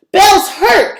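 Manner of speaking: high, loud alarmed shout. A narrator's reading. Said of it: "Trey shouted".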